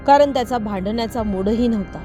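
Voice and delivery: plain reading voice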